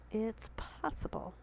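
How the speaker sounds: soft, slow whisper to herself. Real, not acted. To viewers, repeating it in a slow whisper. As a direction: breathe it out like a private reassurance.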